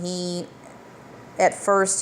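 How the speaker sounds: ordinary delivery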